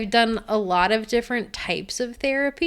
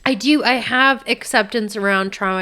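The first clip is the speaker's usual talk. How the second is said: ordinary delivery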